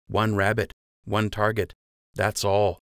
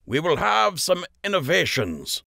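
higher, ringing announcement; Master Fletcher announcing; 10 semitones higher than usual. A narrator's reading. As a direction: tease the change to the crowd. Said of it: "Master Fletcher said".

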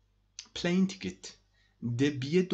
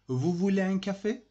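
Vous voulez un café asked higher, with voulez rising; offering question; 7 semitones higher, 4 dB louder, faster than usual. Shown as a demonstration. Teacher to class, offering coffee in a high rising question. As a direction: lift it like an offer. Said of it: "it's an offer".

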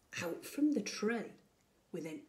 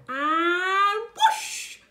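high, loud swooping whoosh; thrilled takeoff call; excited whoosh; 8 semitones higher, 9 dB louder than usual. Acted. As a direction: launch the broom with a big whoosh. Broom takes off.

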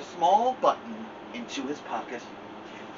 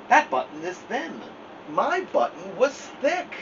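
higher, insistent Toad voice; fussy Toad; 7 semitones higher than usual. Acted. Toad protesting to Frog, higher and insistent. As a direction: Toad protesting it is not his.

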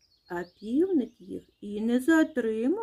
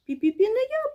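high, loud little mouse squeak; squeaky mouse refusal; 12 semitones higher, 5 dB louder than usual. A narrator's reading. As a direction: pipe up as a second tiny mouse. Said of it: "пищить верть".

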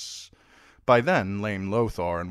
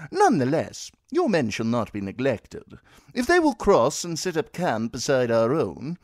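higher, smooth courtly voice; a young noble full of smooth excuses, raised pitch; courteous Frey heir; 6 semitones higher, 5 dB louder than usual. Acted. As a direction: play a polite, eager host lord. Edwin Frey voiced in narration.

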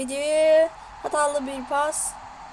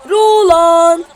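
screaming football commentator; 9 dB louder, much slower than usual. Real, not acted. Announcing goal scorer.

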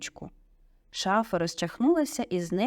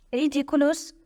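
high exclaimed spell; 8 semitones higher, 4 dB louder than usual. A narrator's reading. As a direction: call out the incantation, pitch up. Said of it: "вигукнув Шеймус".

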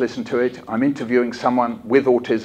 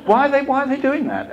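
puzzled Brit; 13 semitones higher than usual. Retold or imitated. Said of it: "And you go".

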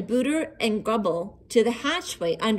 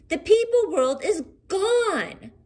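alarmed; 9 semitones higher, slower than usual. A narrator's reading. Hughley's alarmed announcement.

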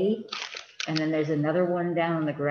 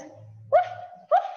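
very high yappy puppy bark; puppy woof. Acted. Reading to a child, high puppy woof. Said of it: "my woof is a little too high-pitched".

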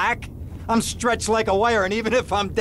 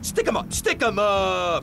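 slow, hammed-up robber voice; mock stick-up man; slower than usual. Lawyer pretending to rob Tommy, hammed-up and slow. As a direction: play at being a gunman, drawing it out.

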